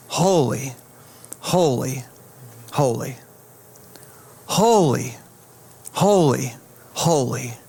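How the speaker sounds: low, hushed awe with long falling words; reverent refrain. Acted. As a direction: intone each word slowly, letting it settle. Quoting heaven's endless worship.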